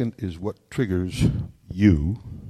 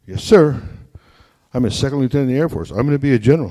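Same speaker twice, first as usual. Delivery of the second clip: put-on eager young officer; 6 semitones higher, 7 dB louder, faster than usual. Eager young officer answers father.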